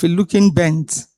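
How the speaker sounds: normal talking voice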